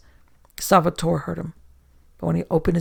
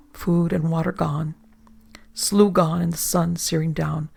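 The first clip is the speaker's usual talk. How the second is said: usual speaking voice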